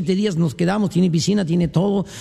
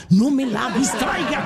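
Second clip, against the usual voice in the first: higher, very slow and pleading; the man shooing the fly from his dying mother-in-law, very slow and higher; drawn-out plea; 5 semitones higher, much slower than usual. Acted. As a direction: stretch it out, begging. Repeat, drawn out.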